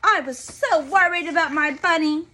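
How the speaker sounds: put-on relieved child gushing; Sonia's voice. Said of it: "said Sonia".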